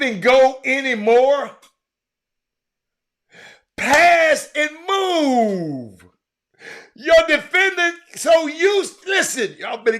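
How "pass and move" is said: anguished shout. Real, not acted. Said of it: "pleading with coaches".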